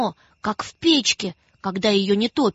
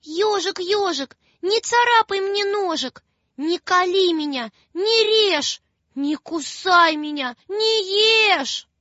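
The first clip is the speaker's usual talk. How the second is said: put-on higher, pleading little girl; tearful plea; 7 semitones higher than usual. Маша просит ежа отпустить её, higher pleading. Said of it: "Вот и говорит она ему".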